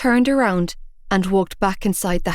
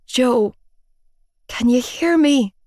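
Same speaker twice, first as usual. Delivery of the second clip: tearful pleading; 4 semitones higher, slower than usual. Acted. Boyfriend unconscious on floor.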